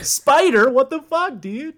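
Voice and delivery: high and loud outraged cry; outraged shout. Real, not acted. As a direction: yell it at the screen.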